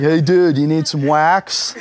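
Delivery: surf shop dude. Retold or imitated.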